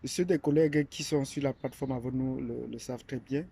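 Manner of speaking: ordinary delivery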